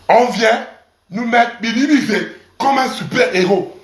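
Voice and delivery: normal talking voice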